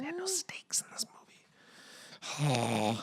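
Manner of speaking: slow, hushed whisper; exasperated whisper. Real, not acted. Complaining about the film's lack of stakes, exasperated whisper. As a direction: whisper it, drawn out.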